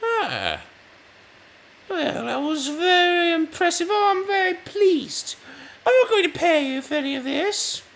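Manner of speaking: high, loud squeaky voice as a smug, stingy king; pleased king Frederick. Retold or imitated. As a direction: Frederick the Second gloating at Bach in a high put-on voice. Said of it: "Frederick, being very pleased, said".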